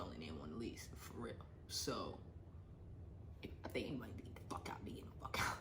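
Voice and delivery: secret whispered aside; confiding to viewers in a whisper. Real, not acted. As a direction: whisper it like a confession. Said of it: "whispered aside about lease".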